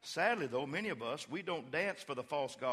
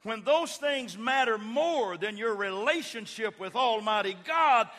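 loud, high, slow preaching climb; full-force preaching; 9 semitones higher, 10 dB louder than usual. Acted. Pastor crying out to his congregation about false gods. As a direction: drive it home to the congregation.

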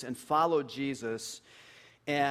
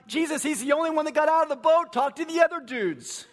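high, loud indignant objection; playfully outraged complaint, loud; mock offended; 11 semitones higher, 6 dB louder than usual. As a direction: object on a friend's behalf, pitched high. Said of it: "I'm a little offended for Peter".

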